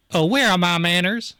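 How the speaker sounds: high, loud Southern neighbor; Southern accent. Acted. Neighbor greeting a newcomer at the door, in a Southern accent. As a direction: play the neighbor Southern and big. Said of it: "I don't know why everyone's Southern".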